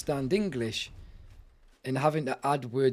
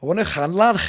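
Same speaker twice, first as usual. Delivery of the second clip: throaty Liverpool accent, hamming it up; Liverpudlian attempt; 8 dB louder than usual. Shown as a demonstration. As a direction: quack it from the throat like a Scouser. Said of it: "quack like Donald Duck as you talk".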